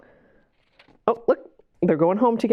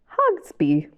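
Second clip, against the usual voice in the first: plain reading voice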